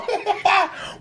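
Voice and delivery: a short high laugh. Real, not acted. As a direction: chuckle at the memory. Laughing at own story.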